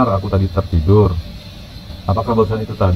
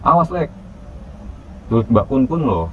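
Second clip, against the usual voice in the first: teasing warning; 5 semitones higher, 4 dB quieter than usual. A narrator's reading. Friend's teasing warning before laughing.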